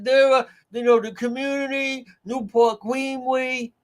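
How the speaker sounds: high-pitched, hammed-up accent impression; mock Charlene Lima accent. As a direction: ham up her strong accent, pitched high. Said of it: "in that strong Eileen Violent accent".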